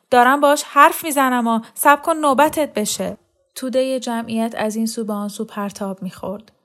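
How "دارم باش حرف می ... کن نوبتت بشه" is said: angry shout, much higher and louder. A narrator's reading. Bloodied woman yelling at Mariam to wait, loud shout. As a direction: snap at the pushy woman to wait her turn. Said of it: "داد زد".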